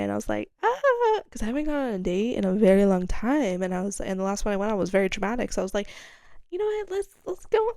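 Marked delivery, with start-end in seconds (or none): 0.6-1.2 very high mock squeal; high excited squeal. Teller reacting to a possible date invite, squealing. 6.5-7.7 very high-pitched self pep talk; retold perky resolve. Teller deciding to retry dating, high and perky.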